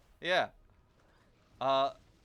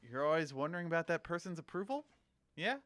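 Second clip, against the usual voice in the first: quick and higher, "approval" rising into a question; up inflection; 5 semitones higher, faster than usual. Shown as a demonstration. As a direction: lift "approval" and "Yeah" as if seeking agreement. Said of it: "because everything ends up".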